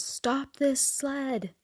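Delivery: high, drawn-out, hushed plea; mock panic. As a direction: act out a panicked thought, stretching each word. Panicked thought while sledding, acted out.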